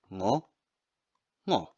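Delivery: Ngo said alone, ng at the back of the throat; isolated syllable. Shown as a demonstration. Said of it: "breaking ngongo into syllables".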